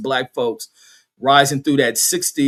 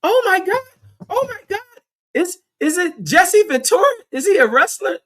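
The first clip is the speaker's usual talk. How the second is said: squealing, much higher mock valley-girl voice. TSA coworker mocking the girl who mistook Jesse Jackson, high squealing. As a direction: gush it out like a starstruck, confused girl.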